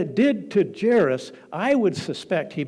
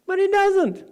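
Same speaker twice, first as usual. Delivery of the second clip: high, loud burst of emphasis; emphatic cry; 7 dB louder than usual. Real, not acted. Preacher to his congregation, a high loud emphatic cry. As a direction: throw it out to the congregation. Preacher stresses Jesus did not wait.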